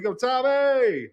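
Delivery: high, slow call across the room. Retold or imitated. Martin calling to Tommy across a room, drawn-out high call. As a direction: stretch out the name to reach him. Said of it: "yells across the room to Tommy".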